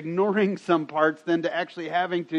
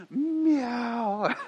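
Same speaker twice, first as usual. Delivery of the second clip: meow; 7 semitones higher than usual. Retold or imitated. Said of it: "She'd go".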